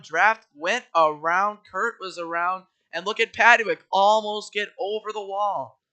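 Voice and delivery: loud live play-by-play; race commentary. Acted. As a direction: call the race excitedly.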